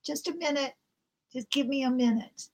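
lightly voiced frail old lady; soft, small old voice; frail old woman. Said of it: "a small, frail voice say".

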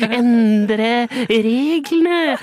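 very slow, each syllable stretched; drawn-out catchphrase. Acted. Host slowly drags out his motto to Rikke.

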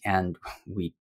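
ordinary delivery

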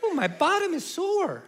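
high, drawn-out ooh in mom's voice; mock-pained mom voice. Mom grabbing her hip at lunch, high mock-pained moan. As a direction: imitate a mother moaning sweetly over a sore hip. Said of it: "my mom grabs her hip and she goes".

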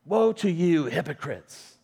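loud, stern movie-style rebuke; angry rebuke. Retold or imitated. Preacher mimics film Jesus rebuking Pharisees, angry and loud. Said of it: "putting those Pharisees in their place".

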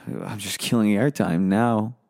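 usual speaking voice

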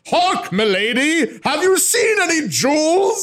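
high, stretched-out theatrical proclamation; hammed-up game character. Game character making an entrance, hammed-up proclamation. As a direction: declaim it like an overblown puppet-show player. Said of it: "People will just walk in and be like".

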